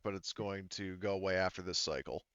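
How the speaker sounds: ordinary talk, nothing marked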